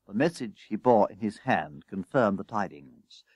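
usual speaking voice

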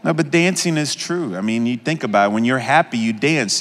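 ordinary talk

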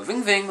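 high, buzzy mimicked phone ring; phone ring imitation. Vampire imitating his phone ringing, high ving ving. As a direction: sing the ring of an old phone. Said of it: "I go".

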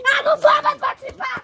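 loud stubborn outburst; shouting tantrum. Acted. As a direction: yell it as a sulky refusal. Acted tantrum in skit.

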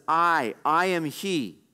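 slow, weighty stress on "I, I am he"; emphatic. Acted. Preacher voicing the Lord introducing himself, emphatic. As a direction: draw out "I, I am he" with a firm pause after the first "I". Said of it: "This is emphatic".

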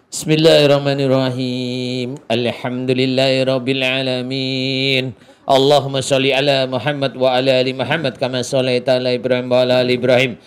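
chanted, steady prayer; prayer recitation. Real, not acted. Healer opening his prayer for Mbak Putri, chanted recitation. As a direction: intone the Arabic evenly, stretching phrase endings.

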